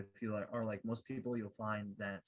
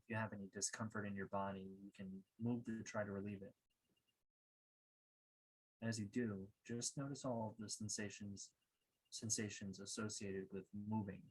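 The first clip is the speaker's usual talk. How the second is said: calm, measured meditation guide, softer than a welcome; guided meditation; 6 dB quieter than usual. Acted. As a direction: guide listeners gently inward, leaving long pauses.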